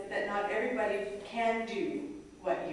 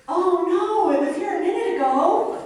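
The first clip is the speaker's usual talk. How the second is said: much higher and louder hammed-up shock, laughing; mock alarm; 11 semitones higher, 12 dB louder than usual. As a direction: fake panic at the lost leg. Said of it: "I looked down, and I went".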